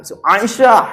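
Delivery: high, loud, overdone pronunciation; mock someone over-pronouncing the name; affected pronouncer. Mocking fussy pronunciation.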